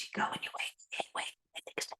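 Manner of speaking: hushed sneaking whisper. Retold or imitated. Kid sneaking downstairs at night, hushed whisper. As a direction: whisper so nobody wakes up.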